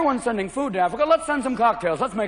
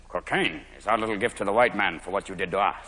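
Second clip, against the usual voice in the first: much lower, grave chief voice; solemn chief; 13 semitones lower, 5 dB quieter than usual. Retold or imitated. Peruvian chief to camera.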